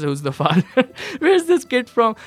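quoting nosy neighbors through a laugh; laughing quote. Retold or imitated. Retelling the townspeople's questions, laughing. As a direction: laugh out the question, pitch jumping up. Townspeople gossiping about mother and son.

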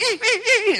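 high squeaky yelps of fleeing bears; bears squealing. Retold or imitated. Preacher mimicking bears running from the rod, high squeals. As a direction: squeal like a whacked bear cub. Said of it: "And they're like".

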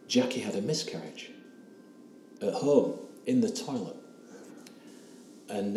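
ordinary talk

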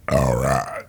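low, subdued imitation of a host; stunned host. Comic mimics the host after the set stops, stunned host. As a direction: drop it low, slow to react. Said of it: "And the host was like".

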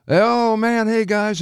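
high, drawn-out delighted hello; high-pitched happy recognition; delighted greeting. Retold or imitated. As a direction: spot old pals across the room. Shecky spotting his friends, acted out.

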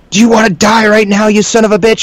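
high-pitched mock yell of a furious cop; imitated angry yelling. Cop threatening his prisoner, yelling. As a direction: act out a captor freaking out. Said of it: "this guy's yelling and freaking out".

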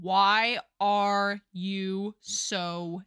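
very slow, drawn-out gruff grumble; slow grumpy Theo. Acted. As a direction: drag every word like a sulky giant.

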